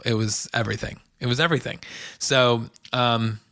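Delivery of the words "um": normal talking voice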